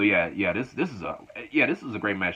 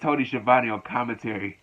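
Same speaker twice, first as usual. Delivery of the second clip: ordinary delivery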